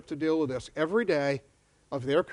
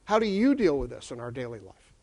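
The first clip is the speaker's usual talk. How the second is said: asked much lower, falling to the end; low falling question; 6 semitones lower than usual. Shown as a demonstration. Lecturer bringing the question home, low and falling. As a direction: drop it low and let it settle.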